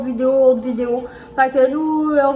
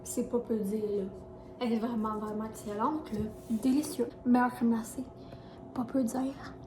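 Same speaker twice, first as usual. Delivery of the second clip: quiet; 14 dB quieter than usual. Real, not acted. Eating ice cream, soft voice.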